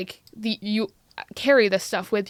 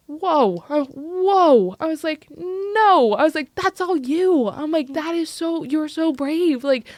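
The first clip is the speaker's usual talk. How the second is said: high, loud, drawn-out disbelief; astonished protest; 8 semitones higher, 4 dB louder, slower than usual. Retold or imitated. Educator replaying her reply to the brave student, astonished. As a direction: retell your amazed reaction, voice soaring.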